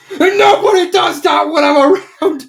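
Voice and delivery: high, loud, worked-up vow; fierce vow. Retold or imitated. Derpy to Starfall about Dinky, fierce vow. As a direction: declare it like a hero stepping up.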